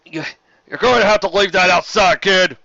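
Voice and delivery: put-on loud, high official shout down to a child; guard shouting down to the filly on her scooter; guard's shout. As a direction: bark it like a stern guard pony. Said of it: "He put on his best guard's pony voice as he shouted down to her".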